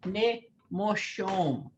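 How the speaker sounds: Ne moshom said slowly, each syllable clear, ne-mo-shom; slow teaching demo. Shown as a demonstration. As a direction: spell it out slowly for the class. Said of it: "When I put ni, N I in front of it".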